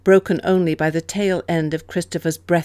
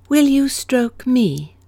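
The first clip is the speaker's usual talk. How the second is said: slow plea; 7 semitones higher, slower than usual. A narrator's reading. Husband's plea to wife after confession.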